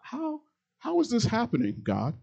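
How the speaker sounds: soaring disbelieving plea; incredulous cry to God. Retold or imitated. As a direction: ask God in high-pitched amazement. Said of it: "and you're going".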